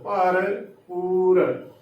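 ਭਰ ਪੂਰ very slow, with a clear p in ਪੂਰ; slow correct pronunciation. Shown as a demonstration. Teacher sounds out the word slowly for the congregation, clear p. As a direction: stretch out bhar poor, pressing the p. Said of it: "ਪੱਪੇ ਨੂੰ ਦਲੈਂਕੜ".

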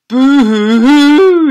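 high, slow, loud mock wail; baby boo-hoo crying. A narrator's reading. Baby wailing at birth, loud hammed-up boo-hoo. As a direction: bawl it out like a huge baby. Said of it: "he cried".

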